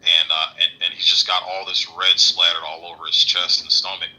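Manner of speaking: ordinary delivery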